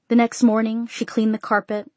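ordinary delivery, nothing marked

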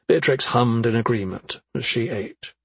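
plain reading voice

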